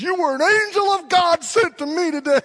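high, loud, choked-up retelling; overcome, crying it out; imitating a man weeping with thanks; tearful gratitude. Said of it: "He bear hugs me in tears".

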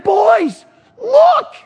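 put-on high, loud call to the boys; excited shout. Dad to sons waking in the tent, excited shout. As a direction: shout for them to see.

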